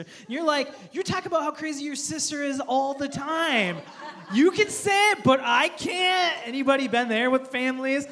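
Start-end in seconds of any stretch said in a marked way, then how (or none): 0.9-6.4 mock outrage, building and dragging out the ending; indignant protest. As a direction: pitch climbs into loud, high complaint. Acted sibling-insult quarrel, double standard.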